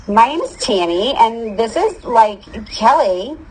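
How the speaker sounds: high, perky cheerleader introduction, lilting; bubbly. A narrator's reading. Cheerleader introducing herself and her friend to freshmen, bubbly. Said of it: "a girl bubbled into the microphone".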